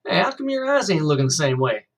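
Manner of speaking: put-on high, loud, fast-talking country boy; Rufus, chatty new kid. A narrator's reading. New kid chattering to Kenny over lunch, high eager country voice. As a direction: yak away like an eager Southern kid.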